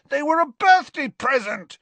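high, loud, indignant yell; shouting. A narrator's reading. Dangling Ron defends where he got sweets, shouting. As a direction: yell it in high-pitched protest. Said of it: "shouted Ron".

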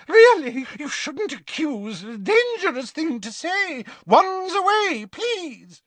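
very high, loud flustered pleading; panicked dressmaker begging them to put wands away; squealing Madame Malkin. A narrator's reading. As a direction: squeak it out, clutching your heart.

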